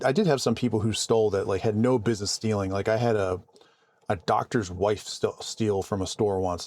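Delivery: ordinary talk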